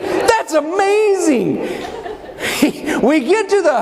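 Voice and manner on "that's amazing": slow, high-pitched wonder; mock amazement. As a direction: imitate a wide-eyed, astonished driver, drawn out. Driver's astonished reply, retold.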